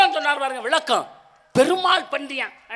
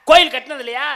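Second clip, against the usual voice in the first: ordinary delivery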